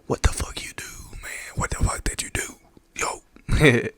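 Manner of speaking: hoarse whispered gangster imitation.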